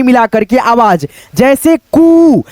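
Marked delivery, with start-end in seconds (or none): 1.8-2.4 "कू" with the ऊ stretched long and firm; teacher demonstrating a long "कू"; drawn-out long vowel. Shown as a demonstration. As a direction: hold the vowel in "कू", pulling it out slowly. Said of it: "थोड़ा सा इसको खींचा जाता है".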